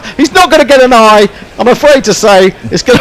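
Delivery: laughing excited commentary. Real, not acted. Overthrows in live commentary.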